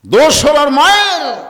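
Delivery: loud, high-pitched outcry; emphatic shout. Real, not acted. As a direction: hurl the line at the crowd. Same line repeated as outcry. (উঁচু গলায় চিৎকার করে)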